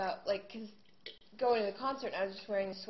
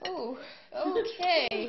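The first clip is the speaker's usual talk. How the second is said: very slow, drawn-out wary okay; deadpan baffled; 7 semitones higher, 8 dB louder, much slower than usual. Retold or imitated. Singer humoring the pumpkin man, slow and wary. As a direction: humor the weirdo without committing. Her baffled reply to stranger.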